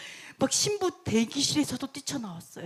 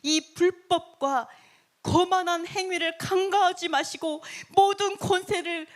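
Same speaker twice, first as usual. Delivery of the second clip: fervent prayer; 4 semitones higher than usual. Acted. People praying against the king's arrogance, fervently pleading. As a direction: call on the Lord with force. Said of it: "어떻게 기도했냐면".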